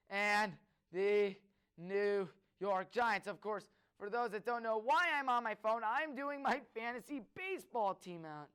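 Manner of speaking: ordinary talk, nothing marked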